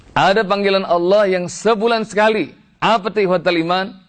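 high, emphatic preacher's call; impassioned preaching. Real, not acted. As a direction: put it to the congregation with fervour. Preaching at raised pitch. (ceramah berapi-api bernada tinggi)

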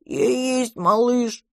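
put-on high, drawn-out little-kid voice; childish, high and sure; childlike monster. A narrator's reading. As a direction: confirm it slowly like an oversized baby. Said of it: "подтвердило чудовище".

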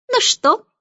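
high, rising gloat; laughing taunt. A narrator's reading. Starling triumphantly flapping his wings, laughing taunt. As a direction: crow it teasingly. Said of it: "засмеялся он".

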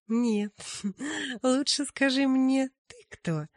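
laughing high and bright; ringing laugh. Acted. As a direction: tease him through a laugh. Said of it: "засмеялась своим звонким голосом".

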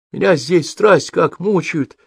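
high, slow child's complaint, fully voiced; heated confiding. A narrator's reading. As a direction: confide woes like a little boy. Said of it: "жарко зашептал он ему в ухо". (жаркая детская жалоба)

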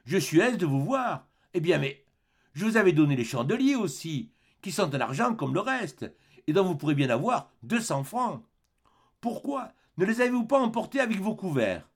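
voix plus haute d'évêque affable; vieil évêque bienveillant. Acted. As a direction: accueille le voleur avec douceur. Évêque accueille Jean Valjean. (l'évêque parle au voleur, aimable et léger)

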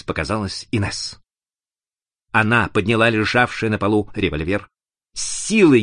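usual speaking voice

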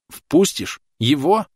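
can't believe it; shocked. A narrator's reading. Thomas shocked that Clotilde will let him in.

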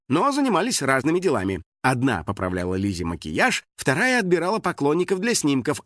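ordinary delivery

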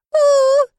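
high, loud drawn-out wail; sobbing howl. Acted. Little dragons still bawling together, high wailing howl. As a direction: howl like crying baby dragons. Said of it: "прорыдали хором мелкие проказники".

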